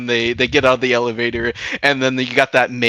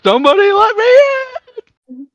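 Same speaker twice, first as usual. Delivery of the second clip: high, loud cry to be let in; hammed-up pleading shout; mock desperate yell; 6 dB louder than usual. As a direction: wail it like someone locked out. Imitates locked-out Louis yelling.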